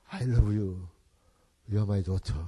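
tender fatherly words. Retold or imitated. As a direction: say it gently, like holding a child close. Father tenderly embracing adopted daughter.

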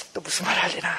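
put-on soft grumble of a wary child. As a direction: grumble under your breath as mum starts talking. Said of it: "엄마가 말하면 탁 이러고 있어요".

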